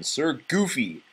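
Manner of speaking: ordinary delivery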